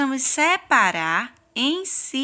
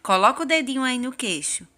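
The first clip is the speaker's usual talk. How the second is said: ordinary talk, nothing marked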